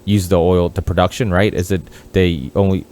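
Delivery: ordinary delivery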